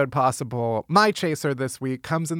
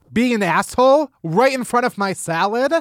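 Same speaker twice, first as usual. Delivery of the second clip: outraged quote; 8 semitones higher, 4 dB louder than usual. Retold or imitated. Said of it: "You say".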